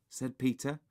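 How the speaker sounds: ordinary delivery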